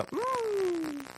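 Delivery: tiny high cat hum; a squeaky little cat noise. Pretend. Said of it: "I'm just gonna go".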